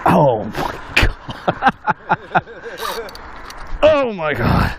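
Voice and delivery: slow, breathless oh my God with a laugh; awed. Real, not acted. Angler amazed at the size of the snook. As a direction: marvel at the fish, slowly.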